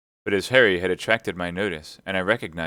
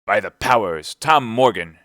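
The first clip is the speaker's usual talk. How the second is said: loud exclamation; exclaiming; 2 semitones higher, 7 dB louder than usual. A narrator's reading. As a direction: burst out at him. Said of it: "exclaimed the landlord".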